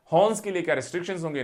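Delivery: ordinary delivery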